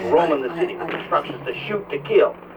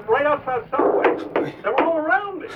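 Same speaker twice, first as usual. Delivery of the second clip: terrified caller; 9 semitones higher than usual. Acted. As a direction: panicky voice, close to crying. Trapped caller surrounded by mutants.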